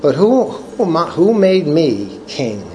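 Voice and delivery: ordinary talk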